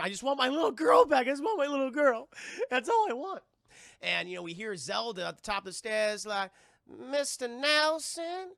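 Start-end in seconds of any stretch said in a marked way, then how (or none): 0.0-3.4 high, loud mock pleading; desperate pleading. Recapper voicing mother who wants her child back, desperate plea. 6.9-8.5 slow, high Southern call; Zelda's high voice. Retold or imitated. Seer calling from the top of the stairs, high drawl. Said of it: "very high voice and everything".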